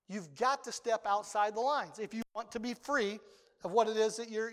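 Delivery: usual speaking voice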